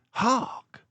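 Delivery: high, sharp hushed call; sudden alarm; alert Basil. A narrator's reading. Basil warning Dawson of footsteps, sharp.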